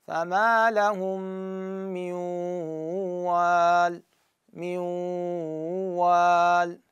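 من وال with a held nasal ghunna, slow; ghunna demo. Shown as a demonstration. Teacher reciting for students to copy. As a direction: hum the نون into وال, then repeat it. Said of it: "شلون أقراها؟".

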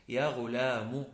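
"غلام" said ghulaamu, ending in u; careful "غلام" finished as ghu-laa-MU; damma on last letter. Shown as a demonstration. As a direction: add a u after the meem of "غلام". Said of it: "بضم الحرف الأخير".

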